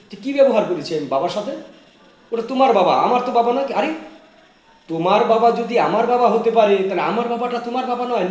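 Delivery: angry retort. Acted. Wife fighting with husband about his father, angry and loud. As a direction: throw his words back at him, heated.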